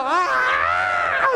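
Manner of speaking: high, long comic shriek; hammed-up falling scream. Skydiver showing his photo, acting out the scream. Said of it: "I'm screaming at".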